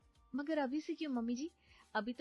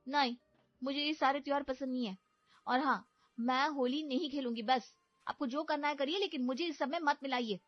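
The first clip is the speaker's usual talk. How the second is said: angry refusal; 6 semitones higher, 4 dB louder than usual. Acted. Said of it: "गुस्से में".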